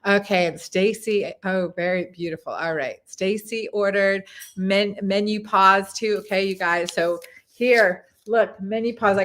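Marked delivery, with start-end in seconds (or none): none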